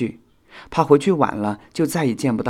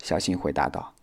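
plain reading voice

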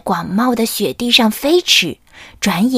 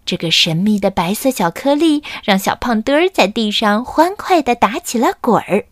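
ordinary delivery

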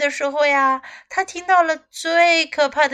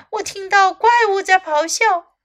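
high, louder storybook exclamation; alarmed cry; 7 semitones higher, 5 dB louder than usual. A narrator's reading. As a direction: call out as the frightened dragon. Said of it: "龙叫道".

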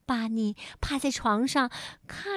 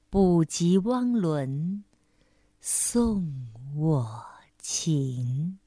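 poem recitation; 4 semitones lower, much slower than usual. Acted. Repeated recitation.

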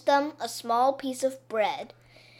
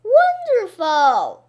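slow, loud, high cry of wonder; loud amazement; 8 semitones higher, 10 dB louder, slower than usual. Acted. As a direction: marvel at it at full voice. Children see candy house.

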